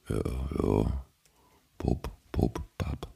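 nodding off mid-word, fading; drowsy. Real, not acted. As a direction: drift off like falling asleep. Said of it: "Nicht, nicht, nicht einschlafen".

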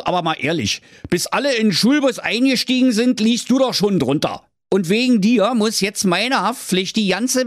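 put-on a pompous dad telling his boy off; father voiced high, scolding then shooing him away.

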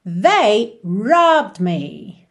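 very slow, careful English model line; teacher modelling at half speed; slow model answer. Shown as a demonstration. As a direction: spell it out slowly for learners. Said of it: "Como é que eu diria".

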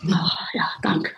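hoarse, rough voice; a rasping, worn-out voice; raspy voice. Real, not acted. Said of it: "hört sich sehr interessant an".

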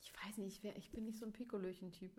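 breathy whispered hedge. Real, not acted. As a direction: whisper it, almost to yourself. Hesitant answer about drinking.

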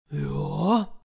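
a lightly growled bear yes; growling bear. A narrator's reading. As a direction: rumble it like a lazy bear. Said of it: "brummte der Bär".

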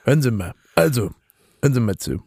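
lightly voiced old chancellor, flustered; a hint of a dismayed elder statesman; Kohl impression. Asked about Russia in the mock podcast, faint Kohl voice.

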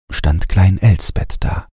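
ordinary delivery

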